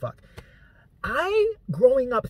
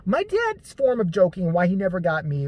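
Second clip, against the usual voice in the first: ordinary talk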